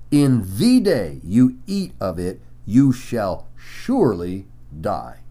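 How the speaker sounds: deliberate, weighty stresses with a pause before "surely"; emphatic. Retold or imitated. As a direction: hit "day" and "eat", then set "surely die" apart. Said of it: "God was very emphatic".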